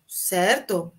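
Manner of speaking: normal talking voice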